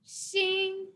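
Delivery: "xin" sustained high and even; level high tone. Shown as a demonstration. Teacher repeating the word for new, high level tone. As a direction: keep "xin" high without a bend. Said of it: "go like this".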